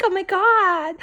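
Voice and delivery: high mock squeal of oh my God; loud, high party exclamation; imitated exclamation. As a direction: voice the screaming staffers, way up. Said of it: "They kept talking like that".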